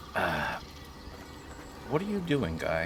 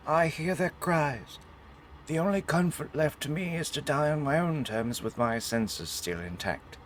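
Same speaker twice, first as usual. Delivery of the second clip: dramatic note reading; 6 semitones higher than usual. Acted. Player reading a dying soldier's farewell note to viewers, mournful. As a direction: give the doomed soldier's last words with weight.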